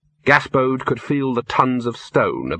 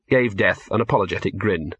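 ordinary delivery, nothing marked

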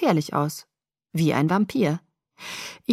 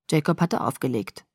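ordinary delivery

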